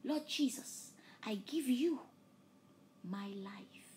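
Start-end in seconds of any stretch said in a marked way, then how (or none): none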